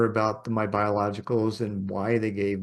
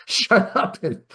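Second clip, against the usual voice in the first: high, louder words through a laugh; 8 semitones higher, 4 dB louder, slower than usual. Real, not acted. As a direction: break into laughter as you say it. Laughs at her own childhood luck.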